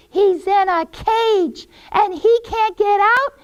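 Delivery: high, loud gloating witch; taunting witch. Acted. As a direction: taunt the helpless girl.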